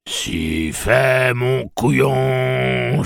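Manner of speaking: loud, high, drawn-out singsong taunt. A narrator's reading. Pirate taunting his victim, singsong. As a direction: croon it out, stretching the last word. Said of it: "chantonna Gasher".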